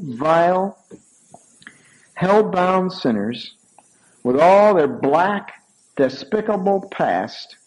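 slow, weighty sermon build; slow preaching build. Acted. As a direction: lay each word down slowly for effect.

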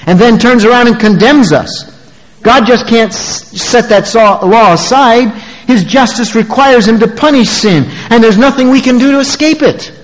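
high, insistent pulpit voice; full-force preaching. Real, not acted. Preacher tells congregation the law condemns, full-force preaching. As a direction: drive it home like a stern sermon.

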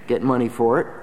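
ordinary delivery

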